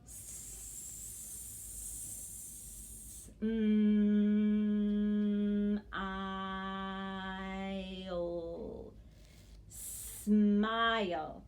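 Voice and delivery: "smile" split into long held sounds, then blended; very slow segmenting. Shown as a demonstration. One circle for every sound, very slowly stretched. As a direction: hold each sound of "smile" for seconds, then join them. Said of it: "I have to go slowly".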